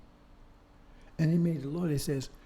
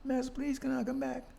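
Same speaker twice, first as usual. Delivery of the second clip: higher mock pleading; imitating a begging slave; 9 semitones higher than usual. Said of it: "Some of them begged".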